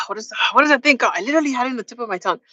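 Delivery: quick whispered word search; fast frustrated whisper. Real, not acted. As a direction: rush it under breath, frustrated.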